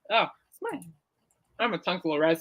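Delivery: talking with sour candy in mouth; mouth full. Real, not acted. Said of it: "Eating these little sour things".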